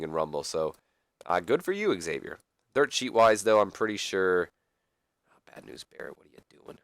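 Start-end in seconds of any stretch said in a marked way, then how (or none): 5.3-6.8 hushed whisper; disappointed whisper at the wrestler. Real, not acted. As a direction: whisper it under your breath. Scolding the wrestlers under his breath.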